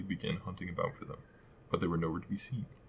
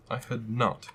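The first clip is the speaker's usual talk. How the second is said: sharp raised retort; sharp angry cry; 5 semitones higher, 6 dB louder, slower than usual. A narrator's reading. Mouse snapping angrily at Alice. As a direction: snap it back sharply and angrily. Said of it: "sharply and very angrily".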